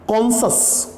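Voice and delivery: कॉन्शस said as two syllables, "kon-shus"; high, careful कॉन्शस in two syllables; correct pronunciation. Shown as a demonstration. Teacher modelling the right कॉन्शस for students. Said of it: "कॉन्शस होता है".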